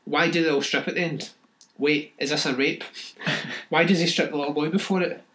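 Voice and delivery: usual speaking voice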